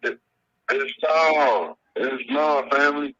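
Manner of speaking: choppy broken phone line; cutting out over a bad signal; radio breaking up. Real, not acted. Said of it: "it sound like it's breaking up".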